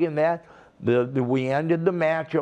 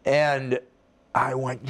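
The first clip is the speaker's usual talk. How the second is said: ordinary talk, nothing marked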